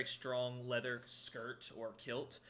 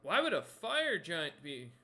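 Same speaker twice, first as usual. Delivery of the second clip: loud, slow, higher-pitched puzzlement; puzzled crewman; 4 semitones higher, 6 dB louder, slower than usual. Acted. Crewman baffled by a fire giant, surprised. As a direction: puzzle it out slowly and loudly. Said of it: "He kinda looks at you and goes".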